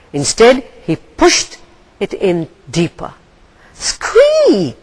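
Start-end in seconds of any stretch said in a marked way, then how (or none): 3.7-4.8 very high bird shriek; pained squawk. A narrator's reading. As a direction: let out a sharp high cry of pain. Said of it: "cried Gull".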